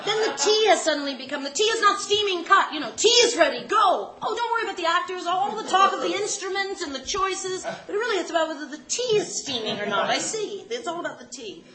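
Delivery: high, loud comic tirade; worked-up mock complaint; mock rant. Acted rant about the tea.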